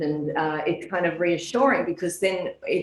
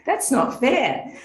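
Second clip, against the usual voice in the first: slow, higher, indignant mock whine; childish protest; 7 semitones higher, slower than usual. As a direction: sound like a wronged schoolkid. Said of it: "And I thought".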